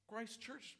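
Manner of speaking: usual speaking voice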